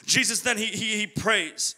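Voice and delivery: ordinary talk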